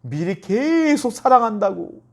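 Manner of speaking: drawn-out, high stress on 계속; slow, high, emphatic swell through 계속; insistent love. Retold or imitated. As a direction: stretch 계속 long to show love that never stops. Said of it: "원망하는 말투를 한 것이 아니라".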